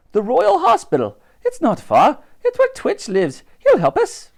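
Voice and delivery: high-pitched, eager character voice; Dottie. A narrator's reading. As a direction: urge the plan on in a high voice. Dottie explains her hiding plan.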